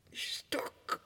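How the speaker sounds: fearful whisper. Acted. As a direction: whisper it as if still hiding. Retelling the beating. (angstig gefluisterd)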